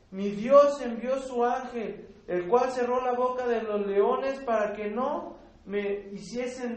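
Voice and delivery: loud, emphatic Bible reading to the congregation; scripture reading. Acted. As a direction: proclaim the passage slowly at full voice. Reads Daniel's scripture aloud. (lectura bíblica enfática y fuerte)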